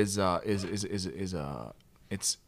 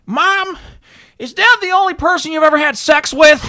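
very high, loud put-on voice; imitated son; 14 dB louder than usual. Son confronting his mom, loud high-pitched imitation. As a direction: play a son blurting out a question, hammed-up.

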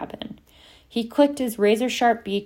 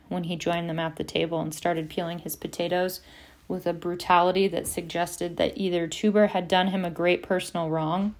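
usual speaking voice

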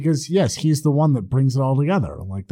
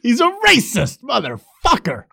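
mock outrage; 8 semitones higher than usual. Joins mock outrage quote.

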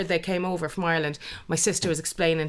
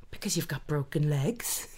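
slow, softer, lower imitation of a child; lightly voiced small boy, slow and quiet; young nephew; 5 semitones lower, 6 dB quieter, slower than usual. Nephew answering about the wheelchair, slow soft imitated child. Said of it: "one moment with my nephew".

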